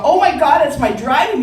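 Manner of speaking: loud, high alarmed cry; horrified realization. Retold or imitated. Teen recognizing instructor chasing the car, horrified cry. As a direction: cry out in sudden dread.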